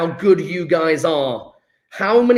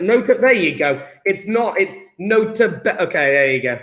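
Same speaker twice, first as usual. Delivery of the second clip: ordinary talk, nothing marked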